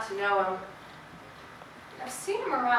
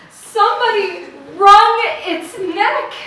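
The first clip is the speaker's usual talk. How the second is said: slow horrified realization; 9 semitones higher, 14 dB louder, slower than usual. Acted. Realizing the bird was killed.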